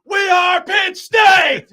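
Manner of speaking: very high, slow shouted chant; big, stretched-out imitation of a stadium chant; fan yelling. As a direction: yell it out like a fan taunting rival players. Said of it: "just yelling".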